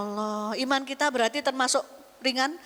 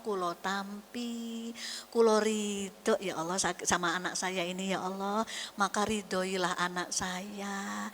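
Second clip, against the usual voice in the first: low, soft, surrendering plea; soft earnest prayer; 4 semitones lower, 5 dB quieter than usual. Retold or imitated. A mother praying softly over her difficult child. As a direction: pray quietly, accepting the child as a gift.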